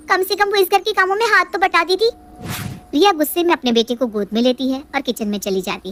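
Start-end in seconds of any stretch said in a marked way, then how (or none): 0.1-2.1 put-on higher young wife, complaining; sulky daughter-in-law pleading her case; higher-pitched aggrieved young wife; Riya's voice. Riya continues complaining.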